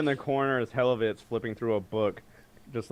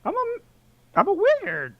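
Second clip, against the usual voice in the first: much higher, nervous little voice; high squeaky wizard; 4 dB louder than usual. Acted. Wizard answers the suspicious warrior, high and nervous. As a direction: squeak it out defensively.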